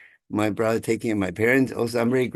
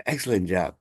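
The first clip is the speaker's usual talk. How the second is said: ordinary talk, nothing marked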